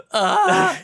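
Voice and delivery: sharp, high choking yelp; pained yelp. Real, not acted. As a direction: cry out while choking on ice.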